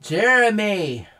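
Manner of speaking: loud, drawn-out call, high then falling; long booming storybook summons; mother's bellow. A narrator's reading. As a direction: bellow the name slowly, like a cross mother. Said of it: "bellowed the canary".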